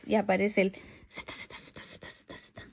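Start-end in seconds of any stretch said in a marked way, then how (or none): 1.3-2.6 snoring sound. Shown as a demonstration. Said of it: "Cuando estamos durmiendo".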